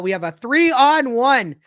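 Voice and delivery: loud, high, stretched announcement; slow it down and belt it out; excited play call. Real, not acted. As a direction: sound the alarm on a sudden rush. Calling a scoring chance live.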